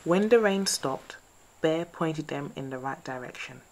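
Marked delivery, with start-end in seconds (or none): none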